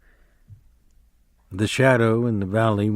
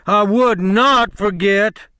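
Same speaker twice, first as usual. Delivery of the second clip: much higher, louder and slow protest; loudly; 11 semitones higher, 6 dB louder, slower than usual. A narrator's reading. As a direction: shout the denial, hurt. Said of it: "Lenny said loudly".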